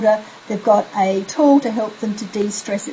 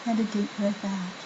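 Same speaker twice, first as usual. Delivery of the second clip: much softer, drowsy breath-out cue; sleepy breathing cue; 12 dB quieter than usual. Shown as a demonstration. Parent guiding a child's last breath out, hushed and sleepy. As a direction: let it fade out like falling asleep. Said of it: "Your voice would get more and more sleepier".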